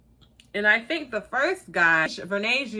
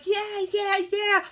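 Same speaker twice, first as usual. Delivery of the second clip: mock chorus agreeing; 9 semitones higher, slower than usual. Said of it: "everybody else like".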